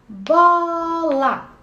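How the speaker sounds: "bola" drawn out as two loud, separate syllables; slow syllable-counting "bola". Shown as a demonstration. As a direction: stretch "bola" into BOOO-LAAA, one beat per press. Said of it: "Cada sílaba a gente aperta".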